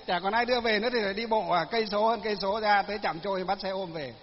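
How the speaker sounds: usual speaking voice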